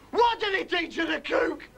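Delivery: usual speaking voice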